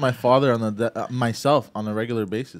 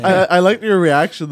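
usual speaking voice

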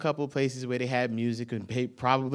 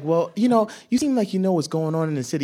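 a touch of the high gushing lady; lightly voiced eager tourist; tourist lady asking; 5 semitones higher, 5 dB louder than usual. Tourist ladies asking for directions.

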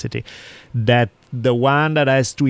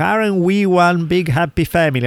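higher-pitched mock family cheer; forced togetherness; 6 semitones higher than usual. Mocking forced gatherings where relatives pretend closeness, falsely chummy and high. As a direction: imitate fake chumminess, pitched up. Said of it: "I hate when they feel forced".

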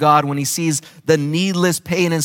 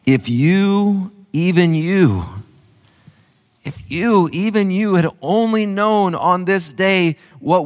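raised, earnest reading of Jesus' words; lamenting scripture reading; 4 semitones higher than usual. Acted. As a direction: read it as the voice of Jesus, pleading.

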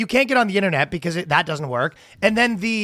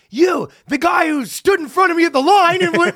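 high, loud accusing call-out; mock shout; 9 semitones higher, 4 dB louder than usual. Stranger at the airport loudly accusing the guy from the line, mock shout. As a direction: yell it like an outraged stranger in line. Stranger calling him out, acted.

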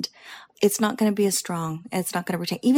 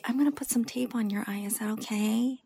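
whispered, softer, permission-seeking; timid whisper; 7 dB quieter than usual. Pretend. Lash tech mocking a timid whispered request to a client. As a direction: whisper it like you're afraid to ask. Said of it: "you don't say".

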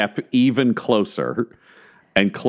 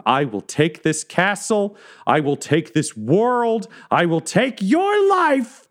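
rising higher until a shrieking final threat; building yell; 11 semitones higher, slower than usual. Acted. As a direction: climb with each vow to a scream. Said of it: "as she yells".

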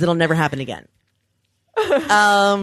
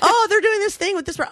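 mock eager news; 12 semitones higher than usual. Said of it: "Yeah, I already read about that".

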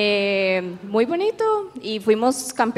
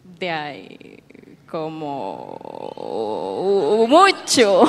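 drawn-out hesitation; 7 semitones lower, much slower than usual. Real, not acted. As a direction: stretch the end of "como", then burst into "mucho". Hesitant estimate, word hugely stretched.

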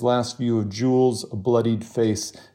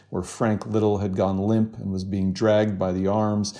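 ordinary delivery

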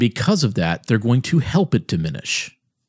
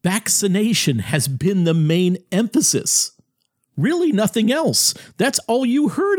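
much higher, heated rant; getting worked up over the vaccine focus, voice rising high; 9 semitones higher than usual. Real, not acted. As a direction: voice climbing as he gets worked up. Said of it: "I'm getting worked up over this".

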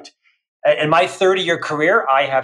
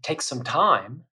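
softer, slower, letting breath in; lowered voice; 3 semitones lower, 7 dB quieter, slower than usual. Shown as a demonstration. Demonstrating a calmer voice to the host, softly. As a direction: drop the voice and breathe. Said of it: "when I lower my voice".

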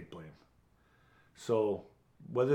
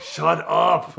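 loud hammed-up growl of shut up; mock demonic yell; 7 semitones higher, 13 dB louder than usual. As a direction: yell it like a possessed man, half laughing.

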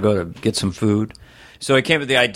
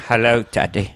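put-on small boy with an old Cockney actor's voice; thick, put-on Cockney greeting; Michael Caine. Little brother answering dad, Michael Caine voice. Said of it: "Michael Caine".

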